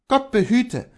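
a defiant man holds his ground; Kohlhaas voice. Acted. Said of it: "erwiderte Kohlhaas".

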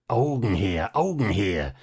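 Coppelius booming a demand, lightly voiced; a dull, droning command from a menacing old man; hint of a sinister intruder calling out. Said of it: "mit dumpfer, dröhnender Stimme".

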